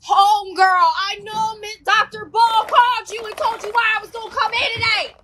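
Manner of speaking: high-pitched, insistent acted comeback; mock indignant retort. Student to nurse who already got the call, mock indignation.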